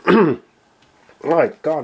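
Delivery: hoarse, voice giving out; rough throat, voice nearly gone. Real, not acted. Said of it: "losing my voice today".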